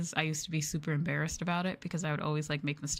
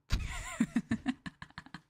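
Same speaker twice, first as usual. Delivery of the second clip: high giggle. Real, not acted. Streamer answers "no" to being shaken, high giggle. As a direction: burst of high-pitched laughter, trailing off.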